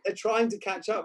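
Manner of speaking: high, loud acted retort; mock calling out. Coach acting out a shouted exchange about dogs left behind, mock calling. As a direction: call it up the field, hammed-up.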